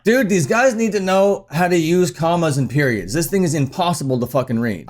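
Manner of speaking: ordinary talk, nothing marked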